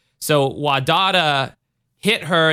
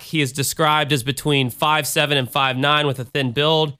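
ordinary talk

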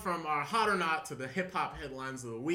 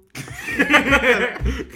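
loud high burst of laughter; 10 semitones higher, 14 dB louder than usual. Real, not acted. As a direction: crack up at the joke.

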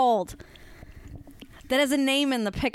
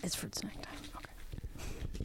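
soft unvoiced whisper; 5 semitones lower, 17 dB quieter, slower than usual. Real, not acted. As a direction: whisper it privately aside. Whispered aside.

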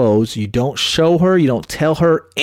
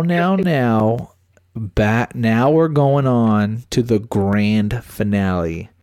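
normal talking voice

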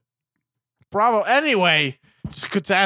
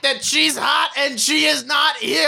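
mocking the movie; 9 semitones higher than usual. Parodying the film's heavy-handed point, high sarcastic shout. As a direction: spell out the obvious at full volume. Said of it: "it's like".

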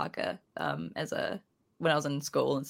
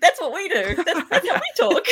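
excited exclaim; 7 dB louder than usual. Retold or imitated. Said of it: "I was like".

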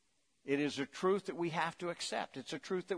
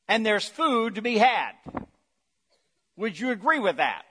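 normal talking voice

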